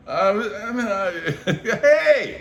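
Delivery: hesitate, then jump up to a laughing hey; startled. Retold or imitated. Strozzi staring at ant heads on finger, high laughing yelp. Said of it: "Strozzi's looking at his finger going".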